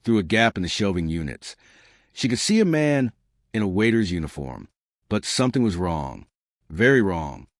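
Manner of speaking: usual speaking voice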